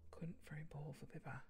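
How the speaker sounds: hushed whisper to herself. Real, not acted. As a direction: mutter it in a whisper. Whispered aside.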